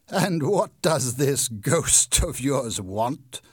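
loud, slow mocking laugh; laughing scorn. A narrator's reading. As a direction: scoff at the ghost story. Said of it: "laughed Monsieur Richard".

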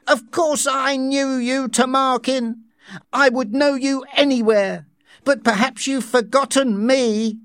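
reach out to a lost lover; pleading woman voice. Acted. Linda addresses the director.

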